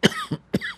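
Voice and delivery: real hacking cough; throat-clearing cough. Real, not acted. Radio host breaks off his restaurant review, coughing. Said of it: "let me cough again".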